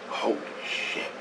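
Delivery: whispered awe; stunned whisper. Retold or imitated. Husband stunned by what his wife says, whispered.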